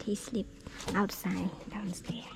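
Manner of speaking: hushed whisper. Real, not acted. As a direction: whisper so dad stays asleep. Dad sleeping nearby.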